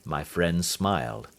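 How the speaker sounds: ordinary delivery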